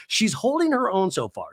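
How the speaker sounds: ordinary talk, nothing marked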